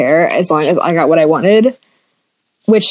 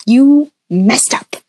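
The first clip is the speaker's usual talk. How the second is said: slow whispered accusation; retold whisper at the teacher; accusing whisper; 4 semitones higher, much slower than usual. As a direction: hiss it at her, slow. Confronting the teacher.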